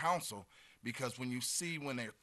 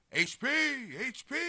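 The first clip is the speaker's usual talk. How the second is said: high, louder calling-out; imitated yell; 12 semitones higher, 6 dB louder than usual. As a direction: imitate customers hollering from the doorway. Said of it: "they yell".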